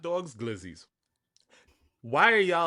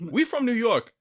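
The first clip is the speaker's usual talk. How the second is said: New York accent, higher and louder; 8 semitones higher, 6 dB louder than usual. Shown as a demonstration. Showing the cohost how New Yorkers say New York, louder. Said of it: "you heard how I just said New York".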